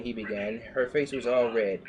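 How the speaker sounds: ordinary delivery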